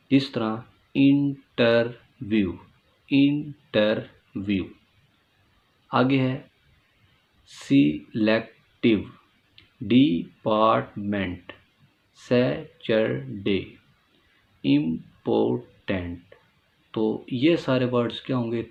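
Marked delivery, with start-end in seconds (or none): 0.9-4.6 इंटरव्यू in three slow separate beats, इन, टर, व्यू; three-part split. Shown as a demonstration. Teacher dividing इंटरव्यू into three parts, slow syllable breaks. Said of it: "तीन हिस्सों में divide करते हैं". 7.5-16.2 सिलेक्टिव, डिपार्टमेंट, सैटरडे, इंपॉर्टेंट slowly broken into three syllables each; three-part split. Shown as a demonstration. Teacher listing three-syllable words, each slowly split. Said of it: "three syllable words होंगे".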